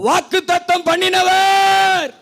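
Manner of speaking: high shout with a long-held last word; as loud as possible. Real, not acted. Preacher leading congregation's call and response, as loud as possible. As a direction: shout the call out, stretching its ending. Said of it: "எவ்வளவு சத்தமா சொல்ல முடியுமோ".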